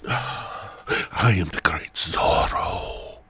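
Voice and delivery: slow breathy whisper in a mock Spanish accent; whispered Spanish-accent Zorro. Speaker announces himself as Zorro in a slow, whispered Spanish accent. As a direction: hiss the name like a masked Spanish swordsman. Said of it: "getting my Spanish accent ready".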